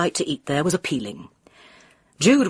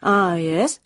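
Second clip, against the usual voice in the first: uninterested. A narrator's reading. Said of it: "without much interest".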